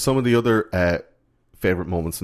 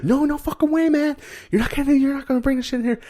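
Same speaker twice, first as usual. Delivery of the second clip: very high, fast, jeering refusal; bullies refusing. Retold or imitated. Bullies shutting Horace out, fast and very high. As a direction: sneer him away from the locked door. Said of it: "the bullies were like".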